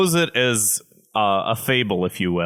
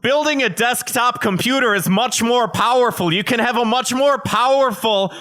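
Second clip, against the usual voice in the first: mock the desktop bros, getting higher and more frantic until shouting; building rant; 10 semitones higher than usual. Podcaster mocking laptop advice-givers, escalating to a high shout.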